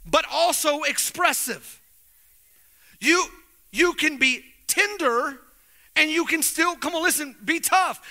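rising preacher cadence, pushing; preaching. Acted. Pastor preaching to the men, full force.

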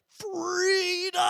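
high, long-drawn battle scream; William Wallace. Acted. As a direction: scream it out like a last-breath war cry. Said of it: "he screams at the top of his lungs".

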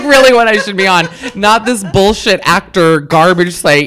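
loud, laughing enthusiasm; excited gushing. Real, not acted. Guest raving to hosts about a date with a butcher, excited gushing. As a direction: gush loudly over the butcher, riding the laughter.

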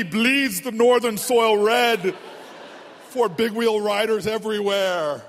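very low, loud, slow trailer voice parodying himself; hammed-up documentary voice; mock epic narrator. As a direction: make a trivial tumble sound heroic. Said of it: "Then my, my beginning".

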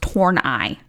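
Horneye said as horn-eye; HORN-eye, slow and clear; correct pronunciation. Shown as a demonstration. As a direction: say Horneye slowly, ending like the word eye. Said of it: "says it's pronounced Horneye".

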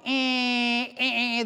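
mock car horn honks, nasal beeps; honking horns. Preacher imitating angry cab horns on Park Avenue. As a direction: honk like stuck traffic horns. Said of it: "I heard all these horns barking".

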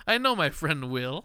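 ordinary talk, nothing marked

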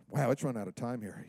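usual speaking voice

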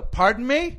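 loud, high "Pardon me?"; mock polite challenge shouted; confrontational call. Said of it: "I go".

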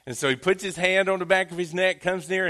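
ordinary delivery

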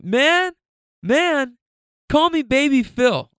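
put-on high-pitched, loud little boy; Baby Phil. Toddler introducing himself to the driver, imitated little-kid voice. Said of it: "he's a little guy".

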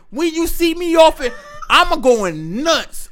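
loud, high-pitched fight hype; hyped-up threat. Acted. Uncle to nephew before a club fight, hyped up. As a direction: work yourself up loud for a brawl.

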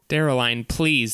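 ordinary delivery

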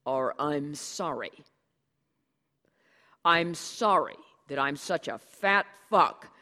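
loud, bitter mock apology; sarcastic diatribe. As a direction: spit the self-insults out loud and bitter. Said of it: "the diatribe backfired".

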